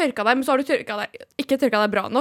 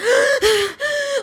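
gasp; 13 semitones higher than usual. Retold or imitated. Shocked inner reaction to firing.

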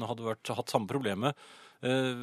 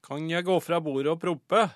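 put-on higher, louder child's voice, carefully enunciated; loud, clear nephew; 5 semitones higher, 6 dB louder than usual. Nephew asking his mother at the table, loud and clear. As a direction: pipe up like a little boy asking permission. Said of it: "spurte høyt og tydelig".

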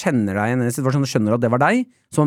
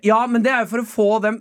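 imitated arguing back; 7 semitones higher, faster than usual. Mother argues back.

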